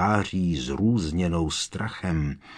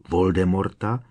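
ordinary delivery

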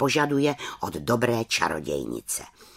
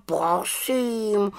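offended; 10 semitones higher than usual. A narrator's reading. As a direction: let the wounded pride trail off. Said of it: "urazil se Abraxas".

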